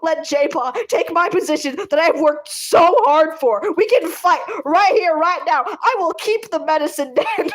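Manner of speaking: hammed-up yelling to defend his den; retold screaming fit, half laughing; imitating a screaming apprentice. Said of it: "starts screaming".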